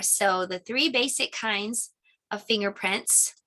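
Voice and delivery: usual speaking voice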